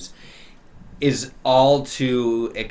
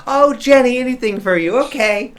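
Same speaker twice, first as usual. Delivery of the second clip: high, loud fawning delight; gushing gnome; 13 semitones higher, 9 dB louder than usual. Acted. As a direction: gush in a high-pitched eager voice. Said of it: "She goes".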